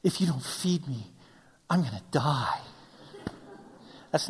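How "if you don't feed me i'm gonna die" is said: mock-starving daughter. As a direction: imitate a child dying of hunger. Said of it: "she walks up to me, she goes".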